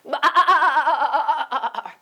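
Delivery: loud bleating growl; mad sheep bah. Acted. Marvin stamping his feet lets out a loud mad bleat. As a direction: let out a big angry bleat. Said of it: "let out a big mad".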